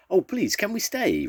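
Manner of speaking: high-pitched eager plea; pleading child. A narrator's reading. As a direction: beg mother in a high voice. Child begging mother to stay.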